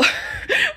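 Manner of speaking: a very high airy squeal of a laugh; squeal of laughter. Real, not acted. Laughs at baby girl.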